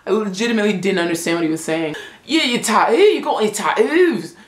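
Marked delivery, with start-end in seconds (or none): 2.2-4.3 put-on British accent with swallowed t's, insistent; mock British accent. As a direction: repeat it like a Brit, dropping t's. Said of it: "Brits say Tattoo".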